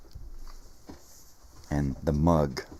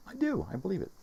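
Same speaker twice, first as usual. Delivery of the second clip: squeaky falsetto, much higher; 3 dB quieter, faster than usual. Pretend. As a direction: pipe it up in falsetto. Very high mock voice.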